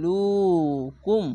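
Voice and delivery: ইউকাতিলুকুম recited slowly in a chanting Quran voice; recitation voice. Shown as a demonstration. Teacher to students, reciting slowly for practice. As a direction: chant ইউকাতিলুকুম with long vowels. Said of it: "খাড়া যাবার এক আলিফ টেনে পড়বেন".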